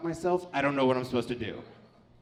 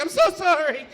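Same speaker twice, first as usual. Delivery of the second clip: high, louder rushed sorry; higher embarrassed sorry; flustered apology; 11 semitones higher, 8 dB louder than usual. Retold or imitated.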